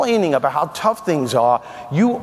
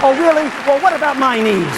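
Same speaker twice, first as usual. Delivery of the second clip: much higher and louder, a hammed-up self-pitying protest; indignant outburst; 8 semitones higher, 4 dB louder than usual. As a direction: explode in mock outrage. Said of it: "This is negative self-talk".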